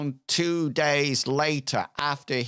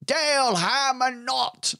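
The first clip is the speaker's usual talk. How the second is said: tavern toast; 11 semitones higher than usual. Acted. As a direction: raise a tankard to fallen heroes. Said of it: "once the rest of the tavern hears".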